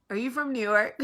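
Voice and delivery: imitating sweet stranger. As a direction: politely ask as the curious stranger. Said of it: "She goes".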